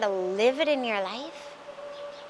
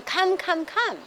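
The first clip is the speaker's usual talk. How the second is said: higher, urgent calling out; 6 semitones higher than usual. Retold or imitated. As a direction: beckon him away in a hurry. Disciples calling him away.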